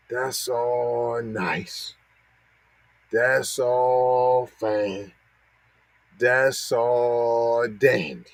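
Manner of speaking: very slow, drawn-out mock approval; sarcastic dismissal. Host brushing off the hate crime bill, sarcastic slow drawl.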